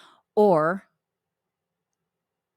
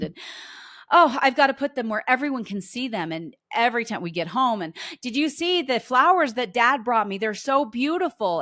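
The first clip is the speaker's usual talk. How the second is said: higher, louder, slowed gushing over flowers; gushing praise; 5 semitones higher, 3 dB louder than usual. Retold or imitated. Mom praising dad's flowers to everyone, imitated gushing. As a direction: overflow with delight at the flowers. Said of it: "she just gushed over these flowers".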